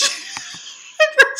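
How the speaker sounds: breathless shrieking laugh; real cackle; high squealing laugh. Real, not acted. Laughing at the scene.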